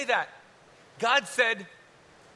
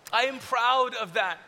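ordinary talk, nothing marked

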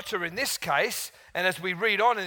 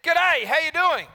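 lightly voiced Australian greeting, higher; a cheery Australian hello, pitched up; Aussie greeting; 10 semitones higher, 7 dB louder than usual. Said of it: "He said".

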